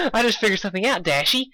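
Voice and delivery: put-on high, bubbly pony girl; chirpy pitch way up; Pinkie's voice. A narrator's reading. Narrator voicing Pinkie calling out to Dashy, high.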